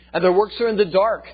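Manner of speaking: high, loud scripture declamation; full-force preaching. Acted. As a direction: hammer out verse after verse at full voice. Preaches rapid chain of verses.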